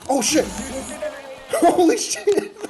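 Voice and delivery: real high, loud shock breaking into laughter; jump-scare outburst, loud and high-pitched; startled yell. Real, not acted. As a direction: yelp in shock, then crack up. Startled by something in the game.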